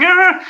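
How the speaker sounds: very high puppet yelp; a sudden squeal way up high; Kermit's flailing yelp. Shown as a demonstration. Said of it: "Kermit's like".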